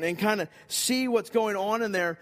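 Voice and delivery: normal talking voice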